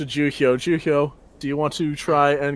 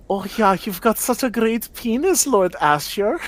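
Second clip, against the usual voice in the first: put-on silly high Swedish accent; 9 semitones higher than usual. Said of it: "in some weird Swedish accent".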